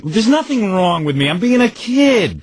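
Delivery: slow, emphatic protest rising high; indignant protest. Retold or imitated. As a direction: argue back like an exasperated kid. Acted reply to mother's reproach.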